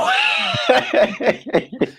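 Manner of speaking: very high squealing laugh. Real, not acted. Cohost squeals with laughter at the freeway joke.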